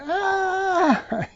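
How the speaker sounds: very high, held imitation wail; mock infant wail; newborn's hungry cry. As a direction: cry over the excuses like a baby. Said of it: "just like a newborn baby desires the milk by going".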